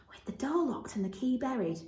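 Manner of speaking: soft whispered musing; murmur. A narrator's reading. Dickon puzzles over the locked garden, soft whisper. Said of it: "he murmured".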